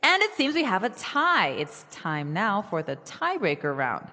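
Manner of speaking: brisk quiz-host announcing; game show host building the pressure on the teams. Acted. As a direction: run the tiebreaker like a TV host. Host runs quiz tiebreaker skit.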